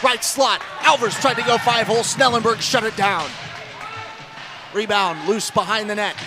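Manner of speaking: usual speaking voice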